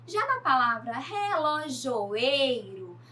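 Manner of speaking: relojoeiro said slowly, the "ei" leaned on; teacher spelling out the stress. Shown as a demonstration. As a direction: drag relojoeiro and punch its "ei". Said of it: "o ei mais forte".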